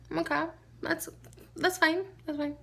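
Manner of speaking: high-pitched, tentative letting it go; mock acceptance. Woman peeking at people leaving, uneasily accepting. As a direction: talk yourself into accepting it. Retold grudging acceptance of the noise.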